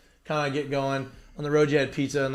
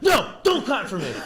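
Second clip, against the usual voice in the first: mock shout back; 7 semitones higher than usual. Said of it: "I'm like".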